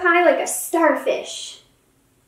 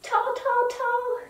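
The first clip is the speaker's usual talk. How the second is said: high, sung-up tall, tall, tall; stretching up tall; 9 semitones higher, 4 dB quieter, slower than usual. Pretend. Mom cheering child to stretch taller, high sung words. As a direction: reach up with the voice. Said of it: "get a little bit taller".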